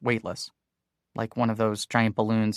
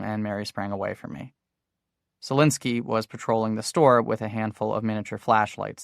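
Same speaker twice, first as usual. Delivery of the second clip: plain reading voice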